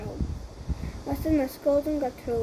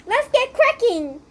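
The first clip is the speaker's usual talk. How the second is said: higher, louder kung fu yell; eager hero battle cry; 9 semitones higher, 5 dB louder, slower than usual. Acted. Kung Fu Chicken bursting into action, high eager battle cry. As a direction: charge in with a hero's shout.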